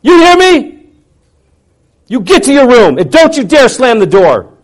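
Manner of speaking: high, full-volume angry command; enraged parental shouting; furious yell. Retold or imitated. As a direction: scream the order at a child. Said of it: "I yelled at him".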